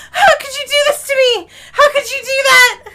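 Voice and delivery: high, loud tearful protest, half laughing; shrieky, betrayed outcry; shaken wail. Real, not acted. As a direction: wail at whoever just scared you. Wails after a jump scare.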